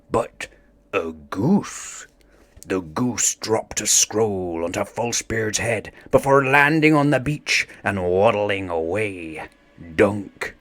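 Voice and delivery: ordinary delivery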